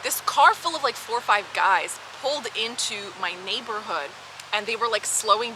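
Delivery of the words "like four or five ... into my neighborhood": ordinary talk, nothing marked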